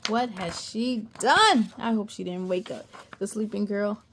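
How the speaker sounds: plain reading voice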